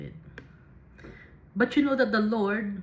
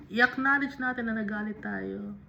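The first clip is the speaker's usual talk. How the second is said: ordinary talk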